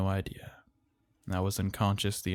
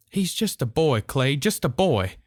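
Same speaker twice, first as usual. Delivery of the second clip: frightened pleading; 6 semitones higher, 7 dB louder than usual. A narrator's reading. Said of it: "Miss Lewis kept muttering".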